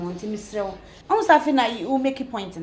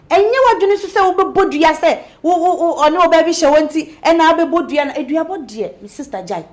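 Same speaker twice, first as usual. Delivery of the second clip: higher and louder, insistent and pressing; loud urging; 5 semitones higher, 8 dB louder than usual. Acted. Stella loudly urges Nancy to confront Dave instead. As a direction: push her hard to go find Dave together.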